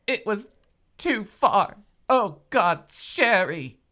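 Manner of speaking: loud, high anguished cry. Acted. Mother crying out over Sherry, loud and high. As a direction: cry out in despair. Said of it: "she whispered".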